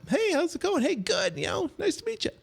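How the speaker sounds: carefree, easygoing hello; nonchalant. Retold or imitated. Confident investor casually greeting a seller, breezy high hello. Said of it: "you'd be nonchalant".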